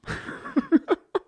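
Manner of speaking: squeaky burst of laughter; a quick high giggle. Real, not acted. Laughing at own joke.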